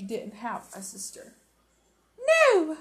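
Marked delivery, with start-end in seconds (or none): 2.2-2.8 sharp, high and loud no. Acted. Wife cuts her husband short, sharp and high. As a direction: bite off the word sharply. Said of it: "she said sharply".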